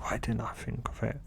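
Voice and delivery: muttering to himself, kicking himself for missing it; frustrated whisper. Acted. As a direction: hiss it under your breath in frustration.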